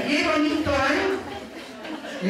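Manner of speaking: high, brisk sarcastic telling-off; reto, regañina. Shown as a demonstration. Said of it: "Es un reto". (regaño exagerado, en tono agudo)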